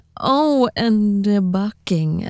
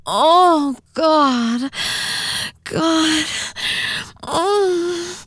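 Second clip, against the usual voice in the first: moaning; 8 semitones higher, much slower than usual. Acted. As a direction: cry out in pleasure. Climax moaning.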